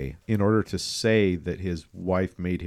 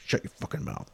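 whispered, menacing imitation of a captor; hushed mock threat; 3 semitones higher, 9 dB quieter than usual. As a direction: hush someone you've just grabbed, under your breath. Voicing a captor grabbing someone.

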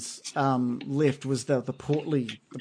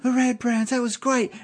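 much higher admiring punter voice; tavern patron; 10 semitones higher than usual. Acted. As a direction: gush like a happy drinker in the inn. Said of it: "hear people inside going".

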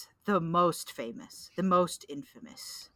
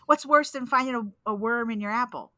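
usual speaking voice

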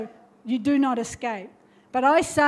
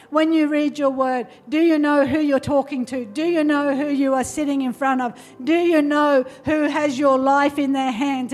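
raised, urgent altar-call preaching; fervent preaching; 3 semitones higher, 4 dB louder than usual. Real, not acted. Preacher urging listeners to come forward and pray, fervent. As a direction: press the congregation with emphatic, insistent appeals.

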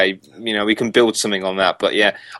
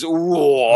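high, drawn-out teasing "ooh"; mimicked ooh; 7 semitones higher than usual. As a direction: imitate a co-host's ooh through laughter. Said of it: "all I heard there was".

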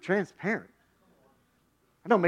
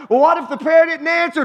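normal talking voice

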